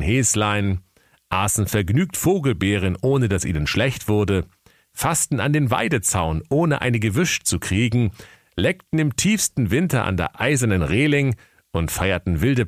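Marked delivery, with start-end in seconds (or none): none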